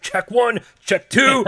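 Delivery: loud mic check. Retold or imitated. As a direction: bark it like a drill sergeant. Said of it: "You're like".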